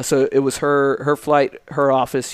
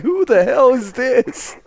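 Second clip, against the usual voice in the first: startled; 11 semitones higher, 4 dB louder, slower than usual. Retold or imitated. Startled by sudden yelling.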